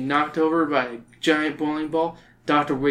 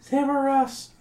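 higher, limp echo of the words; weak Liz voice; 11 semitones higher than usual. A narrator's reading. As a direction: half-heartedly repeat it back. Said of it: "Liz says weakly".

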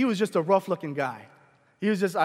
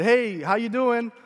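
louder, higher, drawn-out hello; slow, raised-voice retold greeting; called-out greeting; 4 semitones higher, 5 dB louder, slower than usual. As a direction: hail a stranger from a few steps off. Greeting a stranger from a distance.

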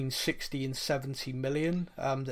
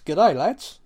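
mock Australian g'day, louder; Australian accent; 3 semitones higher, 11 dB louder than usual. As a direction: greet the lads like an Aussie. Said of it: "Nick's proper Australian".

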